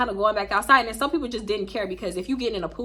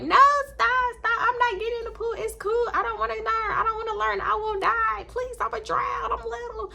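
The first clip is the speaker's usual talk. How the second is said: high-pitched, rapid mock plea not to be thrown in; panicked pleading; 13 semitones higher than usual. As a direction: whine and beg in a high, frantic little voice. Pleading while chased round pool.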